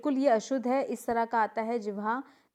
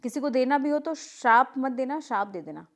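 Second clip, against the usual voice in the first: usual speaking voice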